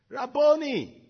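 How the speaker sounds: high, loud cry of recognition. Acted. Mary recognizing Jesus, crying out in Aramaic. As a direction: call out his name in sudden joy. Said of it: "cried out in Aramaic".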